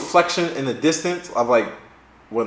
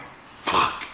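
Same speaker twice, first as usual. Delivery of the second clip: soft voiceless swear; whispered, sinking expletive; dismayed curse; 10 dB quieter than usual. Retold or imitated. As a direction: curse under your breath. Retells dismay at police lights.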